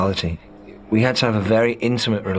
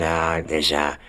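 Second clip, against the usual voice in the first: retold friend's voice; 4 semitones lower than usual. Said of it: "ran in and, and said".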